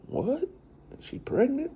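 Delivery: high, hushed what in disbelief; can't believe what she's hearing; friend's wife stunned by the pregnancy news. Retold or imitated.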